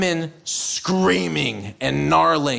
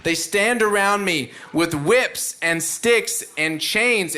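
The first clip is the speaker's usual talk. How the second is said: usual speaking voice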